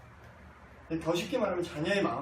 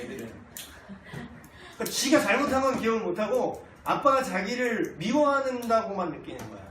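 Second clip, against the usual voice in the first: ordinary talk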